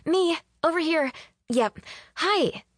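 high, bright-pitched call to a crowd; calling for attention. A narrator's reading. Faye identifies herself to the startled wedding, calling out. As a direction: get everyone to notice you.

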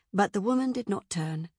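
usual speaking voice

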